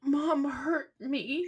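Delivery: put-on high, slow, whimpering little-kid voice; imitating hurt toddler. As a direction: mimic a toddler pouting with a trembling lip. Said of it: "his bottom lip starts trembling".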